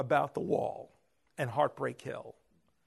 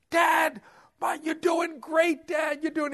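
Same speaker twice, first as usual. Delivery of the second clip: high, loud encouragement, drawn out; excited cheering; 13 semitones higher, 6 dB louder than usual. Retold or imitated. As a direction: cheer him on at full voice. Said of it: "Derek joined him".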